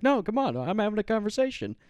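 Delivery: high-pitched retold whiny protest; mock protesting. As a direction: act out pleading while being dragged off. Acted drunk protest while dragged away.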